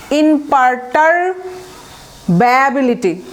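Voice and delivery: ইমপারটারব্যাবিলিটি very slowly, with a long break before the ending ব্যাবিলিটি; very slow teaching demo of ইমপারটারব্যাবিলিটি, chunk by chunk; broken into parts. Shown as a demonstration. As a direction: draw out each chunk of ইমপারটারব্যাবিলিটি and pause between them. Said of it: "ভেঙে ভেঙে উচ্চারণ করলে ভুল হবে না".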